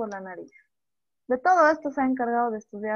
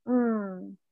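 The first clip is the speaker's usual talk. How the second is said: ordinary talk, nothing marked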